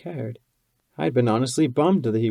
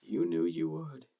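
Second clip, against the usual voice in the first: soft, higher and gentle; mildly hushed reply; quietly; 5 semitones higher, 6 dB quieter than usual. A narrator's reading. Lover answering his partner, quiet and gentle. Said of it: "U twenty-one said quietly".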